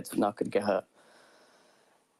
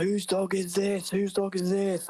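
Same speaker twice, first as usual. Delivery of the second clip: higher, slow, hammed-up yell; imitating an angry stranger calling out, drawn out high; retold shouting; 8 semitones higher, slower than usual. Angry dog owner yelling about the loose dog, retold high shout. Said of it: "heard this guy shouting".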